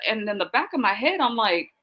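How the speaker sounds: ordinary talk, nothing marked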